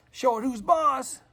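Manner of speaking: playful tough talk. Real, not acted. Urging a stubborn nail in.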